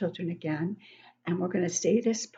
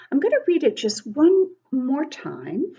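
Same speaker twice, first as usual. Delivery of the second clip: usual speaking voice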